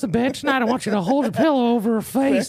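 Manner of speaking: mock a sweet old man savoring murder instructions; creepy high-pitched elderly impression; sinister old man. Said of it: "He's like".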